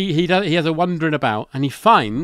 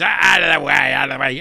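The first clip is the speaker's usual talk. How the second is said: a hint of a gruff caretaker shoving through, a touch louder; barking out of the way, lightly done; mock Filch shout; 3 dB louder than usual. Caretaker pushing through the crowd, mild mock shout. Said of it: "Filch pushes his way through and goes".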